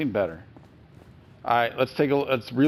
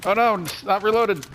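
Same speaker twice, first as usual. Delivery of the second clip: panicking; 8 semitones higher, 4 dB louder than usual. Real, not acted. Weapon not reloaded mid fight.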